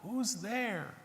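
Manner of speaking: faint, frail voice from behind a door; thin and quiet, like a sick old woman; weak old woman. Acted. Sick old woman answering a knock, faint and frail. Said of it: "A weak voice groaned".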